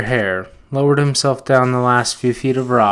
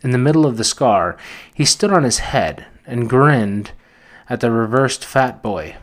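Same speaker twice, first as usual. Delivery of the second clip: ordinary delivery, nothing marked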